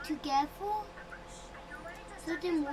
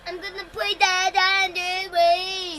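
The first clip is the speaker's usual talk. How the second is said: loud; 2 semitones higher, 11 dB louder than usual. Real, not acted. Kid announcing loudly.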